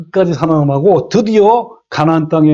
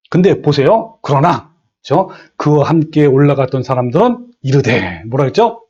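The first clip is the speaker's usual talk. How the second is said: usual speaking voice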